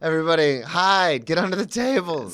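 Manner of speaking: hammed-up warning to hide; mock panic. Mock panic at school shooter.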